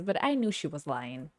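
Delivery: ordinary talk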